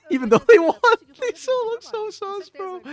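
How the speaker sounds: teasing. Real, not acted. Teasing friend about Flamengo.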